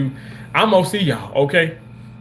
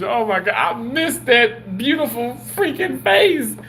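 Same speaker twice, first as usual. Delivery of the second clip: emotional gush; 8 semitones higher than usual. Real, not acted. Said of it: "don't make me cry".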